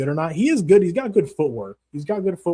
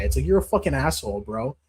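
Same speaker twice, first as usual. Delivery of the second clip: usual speaking voice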